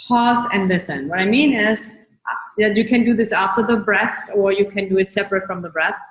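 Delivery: ordinary delivery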